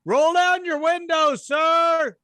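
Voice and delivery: loud, high, barking cop order; officer shouting through the glass; police officer. Pretend. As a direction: command him to roll the window down. Police officer knocks on truck.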